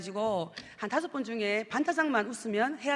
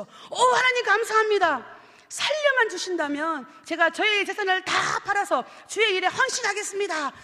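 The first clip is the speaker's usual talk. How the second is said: relieved promise; 7 semitones higher, 5 dB louder than usual. Retold or imitated. Man promises everything to God.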